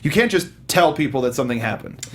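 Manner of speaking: usual speaking voice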